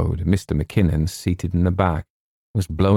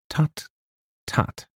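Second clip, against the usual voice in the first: scornful tut; 8 semitones higher, 4 dB quieter than usual. Acted. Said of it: "laughed scornfully".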